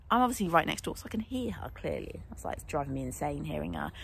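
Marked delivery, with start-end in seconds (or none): none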